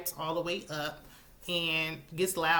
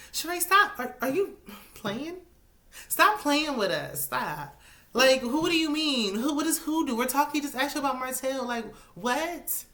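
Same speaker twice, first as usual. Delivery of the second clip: high-pitched, exasperated disbelief; incredulous scolding; 8 semitones higher, 3 dB louder than usual. Pretend. Fan scolding Sheree for playing dumb about Martell, incredulous. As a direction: call her out in mock outrage, pitch jumping up.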